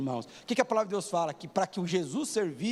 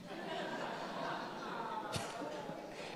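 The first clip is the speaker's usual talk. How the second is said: a quiet, airy burst of real laughter; breathy laugh; 4 semitones higher, 12 dB quieter than usual. Real, not acted. Pastor laughing at daughter's answer: soft breathy laugh. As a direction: laugh softly, almost silent, at the answer.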